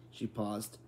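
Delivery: ordinary delivery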